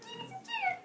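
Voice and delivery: very high feeble squeak; tiny weak plaque voice. Acted. Plaque with no strength begging, high feeble squeak. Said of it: "it would say".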